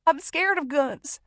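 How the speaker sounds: high, loud, tearful voice; frightened, wailing explanation; terrified confession. A narrator's reading. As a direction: plead fear, close to hysteria. Hostage tells gunman guns terrify her.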